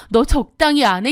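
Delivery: plain reading voice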